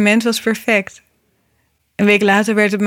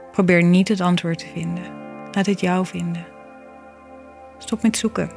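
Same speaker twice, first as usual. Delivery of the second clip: soft spa whisper; 5 dB quieter than usual. Acted. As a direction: read it gently like a calming mantra. Reflective poem read over music.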